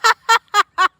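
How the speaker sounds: very high giggling laugh; high giggle. Pretend. Laughing at cat.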